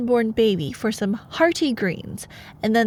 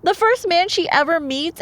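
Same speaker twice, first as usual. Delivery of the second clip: high, loud disbelief; incredulous outburst; 10 semitones higher, 5 dB louder than usual. Real, not acted. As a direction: demand the listener share your shock. Outraged at the story.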